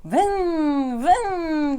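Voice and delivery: playful engine noise. A narrator's reading. Teacher repeats Richard's car game in Turkish, playful engine noise. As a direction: imitate a revving toy car. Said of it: "diye oyun oynuyor Richard".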